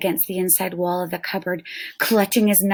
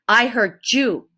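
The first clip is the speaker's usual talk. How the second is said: slow, louder warrior threat; tiny Indian warrior warning the boy; swift warrior threat; 4 semitones higher, 4 dB louder than usual. Acted. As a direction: threaten him flatly. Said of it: "said the Indian swiftly".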